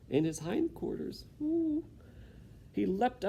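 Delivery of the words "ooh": sharp high ooh; high yelp of pain. Acted. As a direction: cry out in pain. Mouse's pain.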